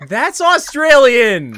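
high, loud, stretched protest; exasperated correction. Real, not acted. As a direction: call out the mistake loudly. Exasperated correction at wrong accent.

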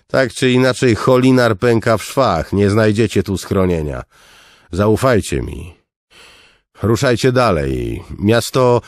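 loud, high, firm guard turning the crowd away; stern gate guard. Acted. Guard to refugees at the gate, loud and firm. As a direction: bark it out like a weary soldier holding the gate.

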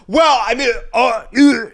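high, loud mock stammering; hammed-up broadcaster grasping for an excuse; flustered broadcaster imitation. Imitating broadcasters guessing after a call.

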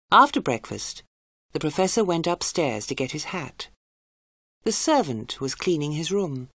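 ordinary delivery, nothing marked

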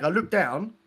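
normal talking voice

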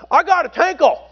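high-pitched Southern teen impression; Dan Parchman. Teen telling pastor he needs a toilet, high imitated voice. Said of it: "That's how he talked".

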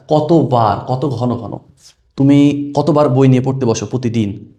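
ordinary delivery

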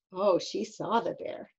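ordinary delivery

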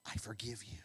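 soft, low and loving; grandma hugging grandson, softly forgiving; tender grandma. Acted. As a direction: forgive him gently, hushed. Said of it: "she said".

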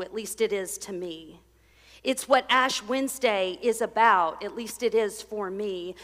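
ordinary talk, nothing marked

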